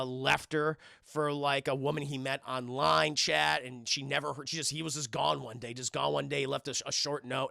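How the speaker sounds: ordinary talk, nothing marked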